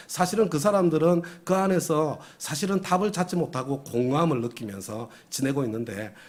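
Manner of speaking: ordinary delivery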